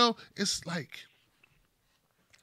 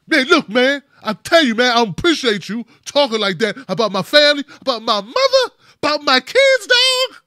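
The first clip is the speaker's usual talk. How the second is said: louder, higher angry chest-puffing; rising fury, climbing to a yell on dog; angry confrontation; 6 semitones higher, 10 dB louder than usual. Retold or imitated. Tim confronting Kevin about his family, loud angry rant.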